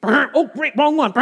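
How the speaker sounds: hammed-up oops in battle; mock dismay. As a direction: react to blowing the wrong signal. Acting out a botched bugle call.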